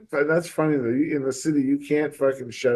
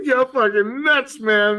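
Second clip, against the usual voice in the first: higher, slow, louder disbelief; incredulous; 11 semitones higher, 4 dB louder, much slower than usual. Retold or imitated. Telling a friend he's crazy, high and incredulous. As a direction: stretch it out like he's lost his mind. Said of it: "I'm like".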